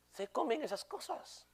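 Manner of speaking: soft, slow mocking sneer in an odd voice; a slow, soft, high sneer; scornful aside. Real, not acted. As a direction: drag it out with contempt. Scornful aside after mock quote.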